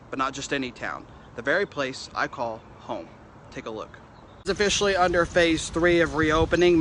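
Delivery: steady anchor-style report; news read. Acted. Reporter reads news packages.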